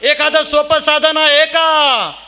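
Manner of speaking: high, loud, drawn-out rhetorical plea; ringing kirtan appeal to the crowd; full-voiced preaching. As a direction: call out the question with long held endings.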